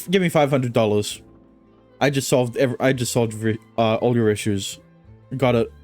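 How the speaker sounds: usual speaking voice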